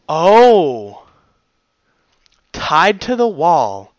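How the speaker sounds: loud, slow shocked exclamation; shocked aside. Real, not acted. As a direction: gasp at the threat. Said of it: "reacts to own narration".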